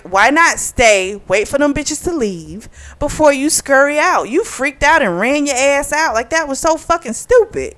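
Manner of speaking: high, exasperated telling-off; exasperated scolding. Retold or imitated. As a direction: scold her for running off. Her own retort to the heroine.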